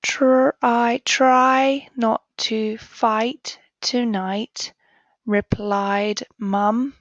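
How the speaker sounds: slow, deliberate read-aloud; teacher modelling careful sentence reading; slow model reading. Shown as a demonstration. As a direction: read it slowly for the children to follow. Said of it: "read it first, then together".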